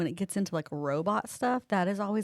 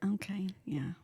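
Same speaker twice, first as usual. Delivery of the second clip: whisper; 9 dB quieter, slower than usual. Real, not acted. Whispering beside the cat.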